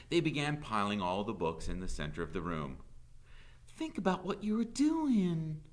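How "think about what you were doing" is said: high, pleading outcry; distressed cry. A narrator's reading. Teacher pleading with the mob, crying out. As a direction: cry out to stop them. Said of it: "cried Miss Catherine".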